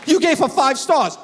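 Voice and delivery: mock outraged friend; outraged high. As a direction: squeal it in disbelief. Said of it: "He goes".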